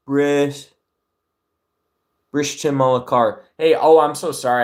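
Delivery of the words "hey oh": ordinary delivery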